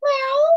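high playful meow calling the cat. Acted. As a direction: meow high up like a kitten to lure her in.

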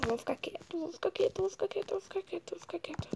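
very soft, repetitive muttering under the breath; barely audible focus mantra; hushed self-talk. Real, not acted. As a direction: chant it quietly to yourself to concentrate. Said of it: "Só preciso ficar quieto".

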